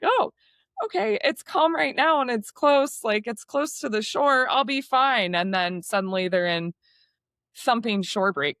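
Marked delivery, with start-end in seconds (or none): 0.0-5.3 higher-pitched mock of naive beachgoers; naive confidence. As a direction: imitate someone blithely sure it's safe. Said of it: "They think".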